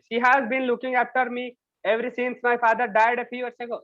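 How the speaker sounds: slow, careful read-aloud for a class; slow story reading. Acted. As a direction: read each word out slowly for students. Teacher reads story slowly aloud. (कक्षा के लिए धीरे-धीरे कहानी पढ़ना)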